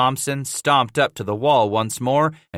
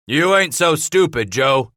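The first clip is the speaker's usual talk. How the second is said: raised, mocking call; cried out; 7 semitones higher, 5 dB louder than usual. A narrator's reading. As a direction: cry it out over the wall. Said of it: "Thompson cried".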